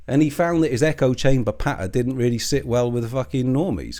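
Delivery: normal talking voice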